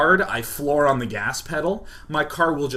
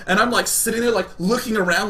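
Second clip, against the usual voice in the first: usual speaking voice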